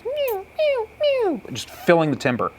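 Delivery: high, thin mew of a cow elk; imitated cow mews. Hunter imitating cows filling the timber, high mews. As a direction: squeal out short high mews. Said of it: "starting to hear cow mews".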